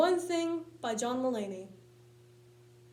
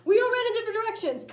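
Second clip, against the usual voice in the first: quick, higher and louder; fast excited; 8 semitones higher, 5 dB louder, much faster than usual. Shown as a demonstration. Comic retelling the frantic escape, fast excited rush. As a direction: rattle it off in a panic.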